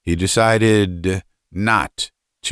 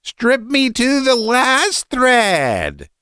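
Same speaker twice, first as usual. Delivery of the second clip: very high, drawn-out shriek; shrill shout; much slower than usual. A narrator's reading. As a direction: shriek it slowly, stretching the words. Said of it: "he shouted in a shrill voice".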